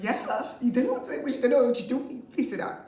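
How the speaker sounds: loud, high mock scolding; teacher telling off. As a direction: scold a pupil while amused. Teacher scolding retold, amused.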